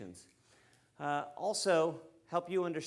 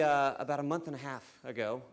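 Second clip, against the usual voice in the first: ordinary talk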